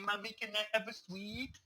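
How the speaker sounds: high, soft, drawn-out mock kid voice; child asking. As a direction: plead slowly like a little kid, amused. Child asking mother, played for laughs.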